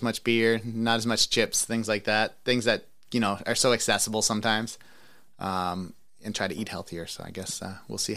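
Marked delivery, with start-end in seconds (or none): none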